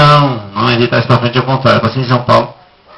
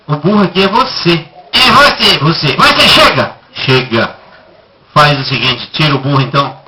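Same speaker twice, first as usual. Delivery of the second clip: ordinary delivery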